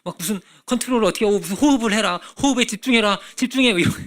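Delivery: mocking meditation coach. Preacher mocking meditation teachers, loud and high. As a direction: scoff at breath-control advice by hamming it up. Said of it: "이런 게 아니야".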